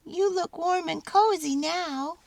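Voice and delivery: high mouse voice with a smile; a tiny mouse, pleased and tender; smiling mouse. A narrator's reading. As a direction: mouse speaking to the baby in the manger. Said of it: "Mortimer smiled".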